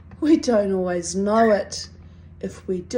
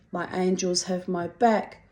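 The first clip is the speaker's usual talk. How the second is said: ordinary delivery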